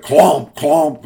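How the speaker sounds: loud, punched imitation of big hooves; heavy clomps. A narrator's reading. Big billy goat hits the bridge, loud heavy clomps. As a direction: hit each clomp like a heavy footfall. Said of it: "a little bit louder".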